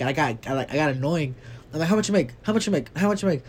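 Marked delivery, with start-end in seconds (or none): 1.9-3.4 high, insistent, needling repeats; pushy repeated demand. Retold or imitated. Arguing with a mom at the dumpster, a pushy repeated demand. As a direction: keep pressing her for an answer.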